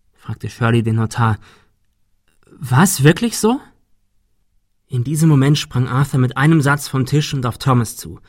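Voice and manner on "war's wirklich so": higher, slow question; disbelieving question. A narrator's reading. Listener asks the notary for the truth, disbelieving. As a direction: ask slowly, pitched up in disbelief. Listener doubts the account of events.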